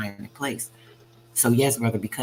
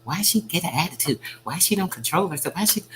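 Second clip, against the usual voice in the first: high, nagging mock gossip voice; whiny imitation of critics; 6 semitones higher than usual. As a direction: mimic judgy people, pitched up high. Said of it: "Maybe they think I should be".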